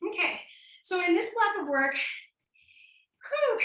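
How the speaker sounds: breathless, panting between words; out of breath. Real, not acted. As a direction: talk while catching your breath. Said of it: "just trying to catch my breath".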